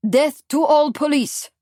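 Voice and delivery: loud, high rallying cry; triumphant cry. A narrator's reading. Pierre holding up the bloody razor, triumphant cry. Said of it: "Pierre cried triumphantly".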